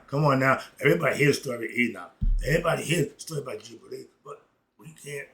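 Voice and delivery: ordinary talk, nothing marked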